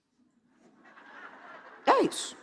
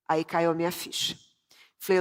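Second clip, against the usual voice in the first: ordinary delivery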